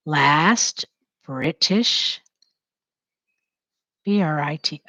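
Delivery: "Last" and "British" each said alone with a rising tune; dictation, word by word. Shown as a demonstration. Teacher dictating to writing students, word by word. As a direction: pace each word to the pen. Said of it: "Say it as you're writing it down".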